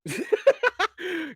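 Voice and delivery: a high, sudden burst of laughter. Real, not acted. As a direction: laugh at yourself. Laugh after "I'm hungry.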